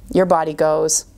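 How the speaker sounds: usual speaking voice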